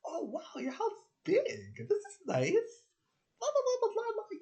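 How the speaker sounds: mock gushing politeness; fake enthusiasm. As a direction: gush over the house, hammed up. Pretending to be impressed.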